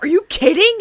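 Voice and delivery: high, loud thrilled squeal; imitating a thrilled nerd; excited Tendi. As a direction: burst with joy.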